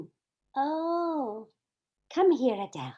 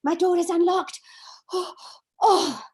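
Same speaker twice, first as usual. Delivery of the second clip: panting after running; gasping between words; out of breath; 6 semitones higher, 7 dB louder than usual. Acted. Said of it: "I'm out of breath".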